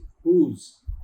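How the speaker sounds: "whose" said as "hooz", ending in a buzzing z; model pronunciation. Shown as a demonstration. Teacher models whose for students to copy. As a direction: say whose slowly, landing on the z. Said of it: "ऐसे आपने pronounce करना है".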